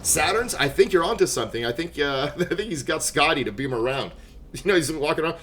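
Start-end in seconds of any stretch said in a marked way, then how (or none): none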